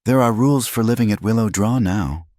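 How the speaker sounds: put-on a small girl laying down the law; lightly voiced serious little girl; little girl announcing. A narrator's reading. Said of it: "the little girl announced".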